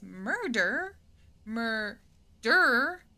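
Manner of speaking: sing-song MURDER, lifted at the end; playful drawn-out murder. Pretend. Streamer hinting at the murder forest, teasingly drawn out. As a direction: stretch MURDER out with a rising end.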